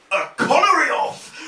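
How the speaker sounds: ordinary delivery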